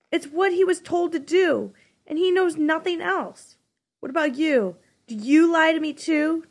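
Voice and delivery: spat-out anger. Acted. Jonas confronting the giver, bitterly spitting the question. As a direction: spit the question at him. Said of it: "Jonas almost spat the question".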